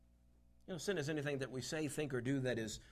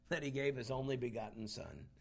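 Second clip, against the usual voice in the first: normal talking voice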